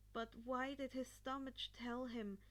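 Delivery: plain reading voice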